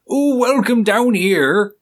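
high, slow West Country drawl on welcome down here; Somerset accent. Retold or imitated. Host imitating a Somerset local greeting, high, slow Somerset accent. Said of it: "they come from an area like Somerset and they talk like".

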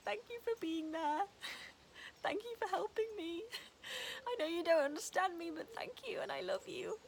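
high, loud mock-tearful gratitude to the dog; tearful thanks to dog. Retelling crying thanks to Teddy, high and wobbly.

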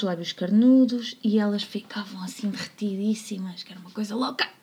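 normal talking voice

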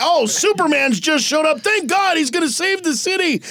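high-pitched live-news excitement, hammed up; excited reporter. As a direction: report the rescue with breathless relief.

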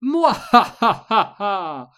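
loud, high, slow villain cackle; evil witch laugh. Acted. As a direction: throw back your head and cackle. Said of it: "leaned back in her throne and laughed".

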